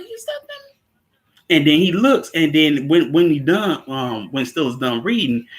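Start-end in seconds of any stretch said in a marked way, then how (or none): 0.0-0.8 much higher and much softer, a squeaky startled ask; tiny high mock voice, hushed; mock surprised question. Voicing a startled character.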